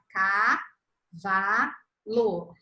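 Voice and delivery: "Cavalo" drawn out very slowly, each syllable apart; "Cavalo" bem devagar, sílaba por sílaba; syllables stretched out. Shown as a demonstration. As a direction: spell out "Cavalo" one slow beat per syllable. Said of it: "Ela vai pegar".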